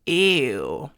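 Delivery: loud, thick, disgusted "ew"; heavy-weight cry of disgust; emphatic disgust. Shown as a demonstration. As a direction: push out a very disgusted ew. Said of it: "very emphatic, very disgusted".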